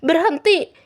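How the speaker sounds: high-pitched call to stop; acted urgent cry; shout. A narrator's reading. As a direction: yell after someone running away. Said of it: "teriak Laura".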